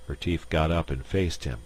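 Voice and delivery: ordinary delivery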